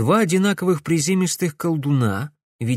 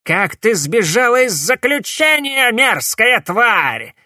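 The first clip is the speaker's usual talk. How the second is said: much higher, loud, slow hateful yell; furious shout; 10 semitones higher, 5 dB louder than usual. A narrator's reading. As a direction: spit it out in rage. Cornelius confronts escaped traitor.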